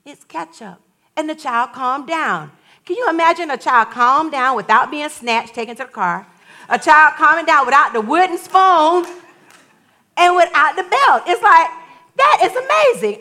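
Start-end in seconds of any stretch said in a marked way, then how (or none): 0.0-0.8 put-on calm child voice. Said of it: "it was like". 12.2-13.2 high, loud amazed exclaim. Real, not acted.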